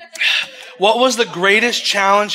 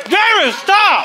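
high yell to stop; 12 semitones higher than usual. Real, not acted. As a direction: shout him down. Yelling at friend.

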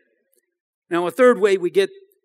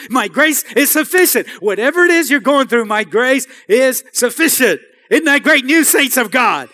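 loud, driving sermon build, louder; preaching at full force; 6 dB louder than usual. Acted. Pastor rallying the congregation, preaching at full force. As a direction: preach it hard to the saints.

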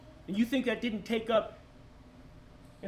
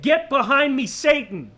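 loud, forceful command; Jesus rebuking Peter loudly; stern rebuke; 4 semitones higher, 9 dB louder, slower than usual. Acted.

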